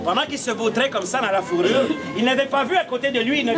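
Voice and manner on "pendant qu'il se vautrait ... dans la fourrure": ordinary talk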